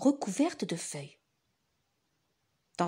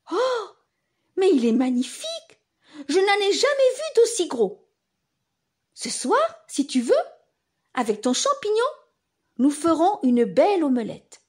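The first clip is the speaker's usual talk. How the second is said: excited; 6 semitones higher, 5 dB louder than usual. Acted. Parent amazed.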